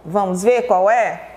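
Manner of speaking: usual speaking voice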